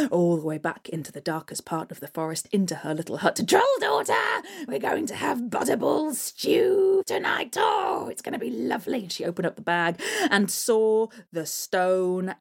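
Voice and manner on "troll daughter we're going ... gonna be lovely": troll hag calling. Acted. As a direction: shout up, gloating. Hag calls daughter.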